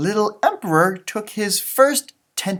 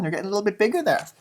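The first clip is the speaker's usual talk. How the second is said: usual speaking voice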